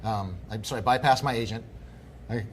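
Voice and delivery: usual speaking voice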